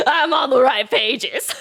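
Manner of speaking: high, slow, laughing through the words. Real, not acted. Streamer repeating a joke to chat, laughing hard. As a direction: giggle it out.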